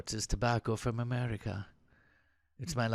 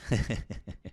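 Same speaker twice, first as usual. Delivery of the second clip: laugh. Acted. Soldier laughs at his own tease of comrade, chuckling. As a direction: chuckle after teasing a friend. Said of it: "They both laugh".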